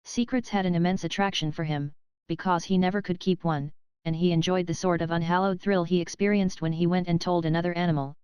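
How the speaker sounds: plain reading voice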